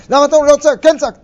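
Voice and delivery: high, loud accusing shout; angry yell. Acted. Father shouting at his son over the yelling, loud. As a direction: bark it back as the father.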